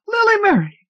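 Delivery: very high, loud excited shriek; a twelve-year-old shrieking for her sister. Acted. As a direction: scream her sister's name in delight. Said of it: "shrieked the twelve-year-old".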